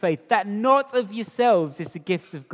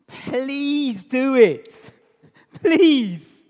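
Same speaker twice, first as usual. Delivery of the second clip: slow, higher pleading appeal; 5 semitones higher, 5 dB louder, much slower than usual. Real, not acted. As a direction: beg them gently to do it now. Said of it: "If you haven't done that".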